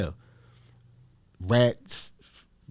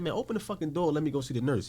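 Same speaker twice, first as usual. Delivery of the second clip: higher-pitched, impatient demand; retold angry demand; 7 semitones higher, faster than usual. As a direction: act out demanding a guard give way. Acted demand to guard.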